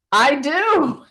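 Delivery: retold shout. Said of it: "And I shouted out".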